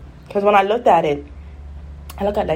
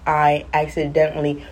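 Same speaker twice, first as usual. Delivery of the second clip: normal talking voice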